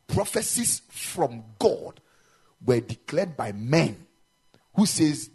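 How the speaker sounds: slow, loud, pounded-out preaching; full-force pulpit delivery; forceful preaching. Real, not acted. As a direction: preach it, dragging each phrase.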